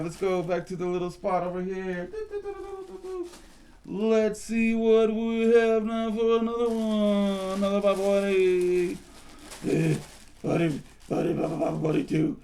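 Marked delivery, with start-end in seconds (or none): 3.9-12.4 congested sick voice; stuffy cold voice. Acted. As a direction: talk like you have a cold. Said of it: "you sound like you got a cold".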